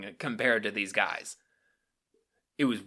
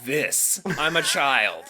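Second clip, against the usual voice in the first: worked-up indignant rant; 5 semitones higher, 7 dB louder than usual. Retold or imitated. Retold outrage continued.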